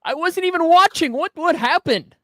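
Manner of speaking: high, stunned questioning; alarmed. Real, not acted. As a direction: ask in disbelief. Friend choking on beer.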